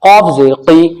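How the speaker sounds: ordinary talk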